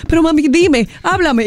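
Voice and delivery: worried little daughter. Retold or imitated. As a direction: call out to mom like a scared kid. Imita a su hija asustada. (imitando a la hija asustada, aguda)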